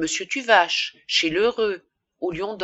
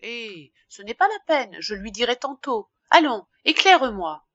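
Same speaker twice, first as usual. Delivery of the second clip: casual brush-off, pitched up; feigned indifference; 4 semitones higher, 5 dB louder than usual. A narrator's reading. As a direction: toss it off lightly, then give the order. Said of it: "Puis d'un air indifférent". (d'un air indifférent)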